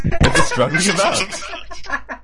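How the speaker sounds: high, slow words dissolving into laughter; breaking into laughter. Real, not acted. Reader cracks up at struggling creature, laughing helplessly. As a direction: lose it laughing as you read.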